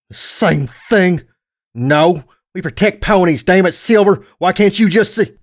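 high, loud, heated protest; exasperated anger. Acted. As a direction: argue in exasperation, pitch climbing. Brother argues back in quarrel.

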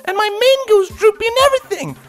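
high bubbly excited pony voice; chirpy hyper pony, talking fast; Pinkie Pie voice. Acted.

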